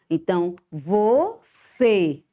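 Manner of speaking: você drawn out slowly, closed cê; slow model word. Shown as a demonstration. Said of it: "o C, usamos o acento circunflexo".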